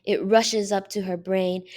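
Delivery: ordinary delivery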